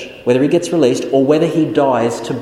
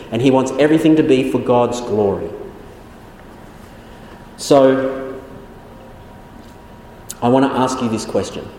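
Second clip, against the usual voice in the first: ordinary delivery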